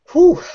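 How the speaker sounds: a loud high whew of relief; sharp relieved exhale. Real, not acted. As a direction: blow it out, glad that's over. Exhale after long rant.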